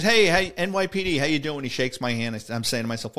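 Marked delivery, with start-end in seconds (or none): 0.0-1.6 a lightly raised, friendly "hey, hey" from a big man; hearty sergeant greeting. Retold or imitated. Sergeant welcoming the off-duty cop, hearty and lightly raised. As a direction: greet him like an old buddy.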